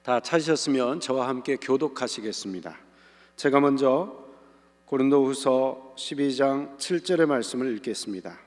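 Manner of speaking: ordinary delivery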